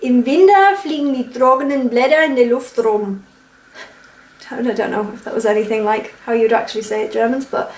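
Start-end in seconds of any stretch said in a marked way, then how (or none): 0.0-3.2 a mild Franconian accent, softened consonants. Shown as a demonstration. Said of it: "How I would imitate a Franconian accent". (fränkischer Akzent, leicht nachgemacht)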